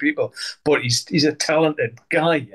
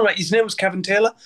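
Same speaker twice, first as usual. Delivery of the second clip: ordinary delivery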